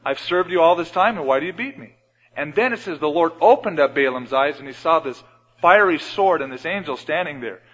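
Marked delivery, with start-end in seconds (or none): 0.0-2.0 higher, louder hurt donkey voice; a lightly voiced donkey protesting to Balaam; donkey pleading. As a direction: plead like a wounded, loyal animal. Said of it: "the donkey says".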